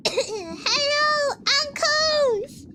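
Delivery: sweet high voice; high cute greeting. Real, not acted.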